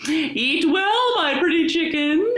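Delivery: sly wolf calling out to the chicken; wolf crying out. A narrator's reading. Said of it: "he cried".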